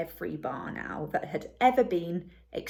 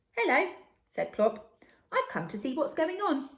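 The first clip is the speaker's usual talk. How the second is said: cheery owlet hello; 7 semitones higher than usual. A narrator's reading. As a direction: bounce it up like a baby owl. Plop greets campfire boy.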